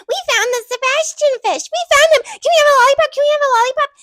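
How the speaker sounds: put-on very high, loud kid voices begging; excited little boys. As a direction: squeal it like an overexcited child. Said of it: "the sons were like".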